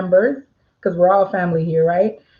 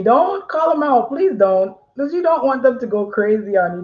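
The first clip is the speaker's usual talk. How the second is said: ordinary talk, nothing marked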